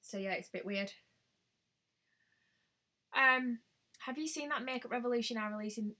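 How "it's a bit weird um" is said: ordinary talk, nothing marked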